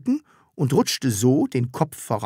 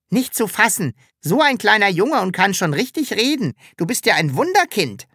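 mocking; 7 semitones higher than usual. A narrator's reading. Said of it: "spottete das Sams".

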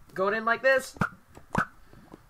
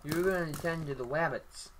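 Elmer Fudd lisp; 4 semitones lower, 5 dB quieter than usual. Pretend. Quoting Of Mice and Men.

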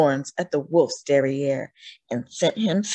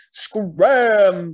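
loud barked order; bellowing ram; 7 dB louder than usual. A narrator's reading. Ram chasing the wolf off, bellowing loudly. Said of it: "bellowed Rambo".

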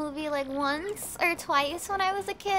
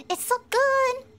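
higher, louder, gushing; excited; 9 semitones higher, 5 dB louder, slower than usual. Shown as a demonstration. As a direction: say it again with more delight. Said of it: "oh, it's so good!".

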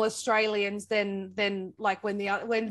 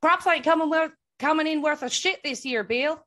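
louder, higher mimicked country farmer; copied old farmer; 7 semitones higher, 6 dB louder than usual. Co-host trying to copy the farmer line, mock farmer voice. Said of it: "you need to say a sentence if I can try and copy you".

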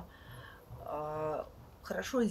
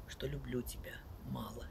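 soft whisper, barely voiced; whispered confession; 5 semitones lower, 9 dB quieter than usual. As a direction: breathe the line out as a secret. Love poem closing line hushed.

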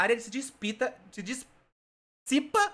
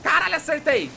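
excited; 4 semitones higher, 6 dB louder than usual. Real, not acted. Landing a hard shot in game.

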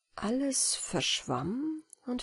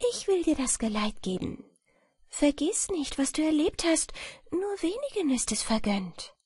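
a hint of a higher, gentle fairy voice; lightly voiced fairy Wieglinde; 6 semitones higher than usual.

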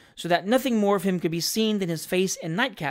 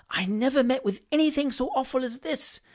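higher-pitched, appalled inner voice; 6 semitones higher than usual. A narrator's reading. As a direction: think it aghast, without raising volume. Pickwick's horrified thought behind curtains.

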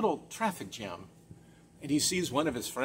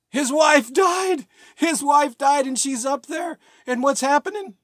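shocked exclamation; 9 semitones higher, 8 dB louder than usual. Real, not acted. Comedian revealing to the audience that the wife died, shocked shout. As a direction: announce the twist in disbelief.

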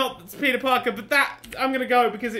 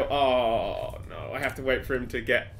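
ordinary talk, nothing marked

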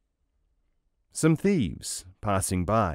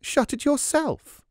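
defiant retort. Acted. Wife retorts in quarrel.

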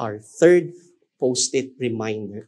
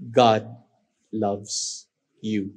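tender, hushed; 4 semitones lower, much slower than usual. Shown as a demonstration. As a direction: lay each word gently on their hearts. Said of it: "I'll say the post-it reminder three times".

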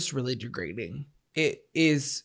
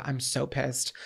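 normal talking voice

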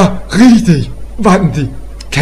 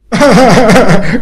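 hearty laugh; 4 semitones higher, 3 dB louder than usual. Acted. Officer laughs at joke.

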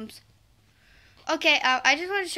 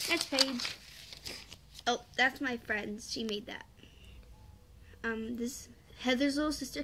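ordinary delivery

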